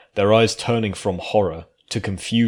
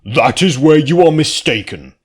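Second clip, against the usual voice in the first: loud, higher, firm declaration; declared; 7 semitones higher, 9 dB louder than usual. A narrator's reading. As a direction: stand up to the creature. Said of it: "Pouty Coins declared".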